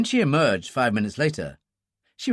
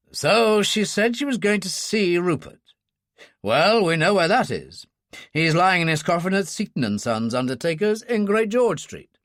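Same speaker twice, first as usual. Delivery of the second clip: put-on stern older officer voice; 5 semitones higher than usual. Ferguson voiced by narrator.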